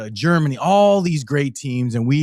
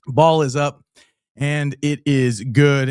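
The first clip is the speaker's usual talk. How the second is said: play-by-play; 2 semitones higher, 4 dB louder, slower than usual. Acted. Calling the extra point.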